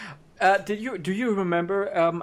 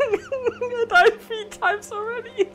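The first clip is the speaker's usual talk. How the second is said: laughing; slower than usual. Real, not acted. Laughing at repeated deaths.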